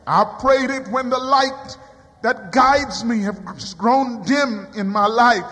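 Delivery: usual speaking voice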